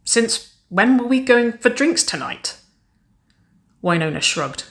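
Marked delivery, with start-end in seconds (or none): 0.0-2.6 high, slow, puzzled question; puzzled and caught off guard; confused. A narrator's reading. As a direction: draw it out in bewilderment. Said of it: "her brow creased in confusion".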